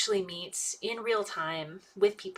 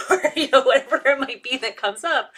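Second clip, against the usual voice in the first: laughing; 5 semitones higher, 9 dB louder than usual. Real, not acted. Laughing after quoted example.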